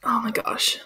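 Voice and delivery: breathy high whisper. Real, not acted. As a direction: whisper it in dismay. Hushed disappointed aside.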